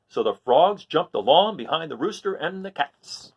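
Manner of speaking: lively read-aloud storytelling; story narration. A narrator's reading. Teacher reading a story to the class, lively narration. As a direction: read it like a picture book to kids. Reads a picture book aloud.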